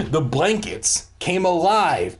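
ordinary delivery

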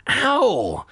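high, acted "ow"; pained yelp. A narrator's reading. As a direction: yelp as if you bumped a sore head. Bangs bandaged head with phone.

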